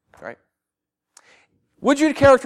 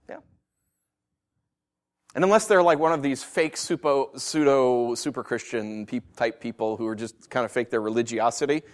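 normal talking voice

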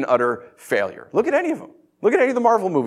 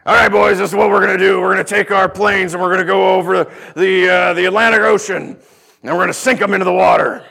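loud, higher rallying officer; wartime briefing; 3 semitones higher, 5 dB louder than usual. Acted. Officer briefing his boys, mock-rousing and loud. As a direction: hype up the boys with the plan. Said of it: "World War Two".

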